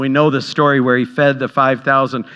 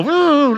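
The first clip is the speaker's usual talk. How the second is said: a sudden squeaky high woo; 13 semitones higher than usual. Retold or imitated. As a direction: let the mind fly off with a high yelp. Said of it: "a mind that likes to go".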